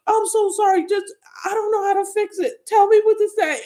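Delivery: very high, flustered imitation; confused pleading. Doctor facing a crying coworker, confused pleading. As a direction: imitate someone helplessly trying to fix it. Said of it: "so confused".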